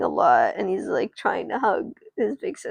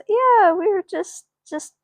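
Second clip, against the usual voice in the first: high-pitched, hurried-to-explain cover story; raised-pitch voice of a sister making excuses; placating big sister; 11 semitones higher, 3 dB louder than usual. Acted. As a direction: step in brightly to cover for siblings. Big sister shielding siblings from dad.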